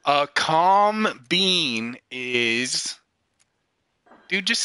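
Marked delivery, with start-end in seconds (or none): 0.0-3.0 very slow, drawn-out prompt. Shown as a demonstration. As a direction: stretch each word, waiting for him to finish. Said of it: "finish the sentence".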